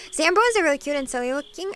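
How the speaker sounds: normal talking voice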